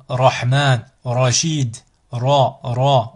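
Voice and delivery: Rahman, Rachid, Ra, each with a rolled Spanish R. Shown as a demonstration. Teacher giving example words for Ra, R rolled. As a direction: roll the first R of Ra, Rahman, Rachid. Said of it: "On enroule, comme le, en espagnol".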